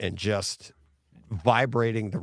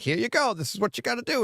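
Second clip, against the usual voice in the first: much higher, breezy mock driving coach; instructor voice; 11 semitones higher, faster than usual. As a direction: lightly play the cheerful coach handing over the wheel. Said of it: "So they'll be like".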